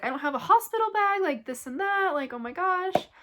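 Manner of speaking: high, loud, fast panic; panicked. Retold or imitated. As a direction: blurt it out in a rush of panic. Panicking about hospital bag.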